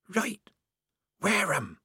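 hoarse whisper. A narrator's reading. Mystery voice in the privy offering help, hoarse whisper. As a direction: whisper it like a hidden accomplice. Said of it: "said the mystery voice hoarsely".

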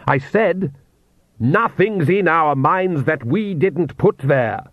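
high, slow, emphatic rebuke; mild roar. A narrator's reading. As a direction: roar it deliberately, without much volume. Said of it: "the Count roared".

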